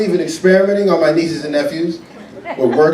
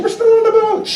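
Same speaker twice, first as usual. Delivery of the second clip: very high, sing-song fib; lying parent on the phone. Retold or imitated. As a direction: sell the lie brightly. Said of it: "hide in the bathroom".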